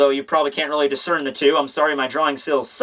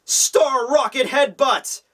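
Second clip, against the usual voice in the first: hammed-up outraged hero, pitched up and drawn out; freaking out; 5 semitones higher, slower than usual. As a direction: build to a slow, high attack cry. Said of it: "just freaks out".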